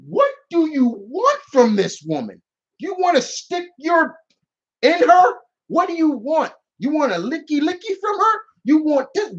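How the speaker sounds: high-pitched, incredulous grilling; exasperated confrontation. Acted. Gamer confronting Bowser about Peach, high-pitched exasperation.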